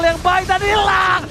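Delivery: excited call. Real, not acted. Caster calls hero lost.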